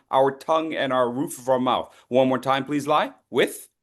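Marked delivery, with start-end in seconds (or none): 3.3-3.7 With with the TH held; long TH. Shown as a demonstration. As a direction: hold the TH in With. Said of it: "lengthen that sound".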